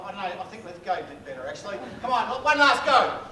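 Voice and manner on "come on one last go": slow, pumped-up urging, pitched high; rally them for one last go; urging crowd. Real, not acted. Comedian rallying the crowd for a final try, high and slow.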